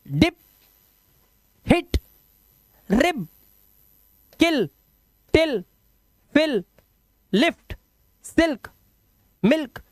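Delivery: डिप, हिट, रिब each short with a quick i; short vowel demonstration. Shown as a demonstration. Teacher drills short i words, डिप, हिट, मिल्क kept brief. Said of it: "ई से पढ़ना है।".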